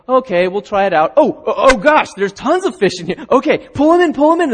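hauling in a huge catch, beside yourself with joy; excited fishermen. Acted. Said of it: "These guys were like".

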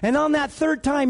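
ordinary talk

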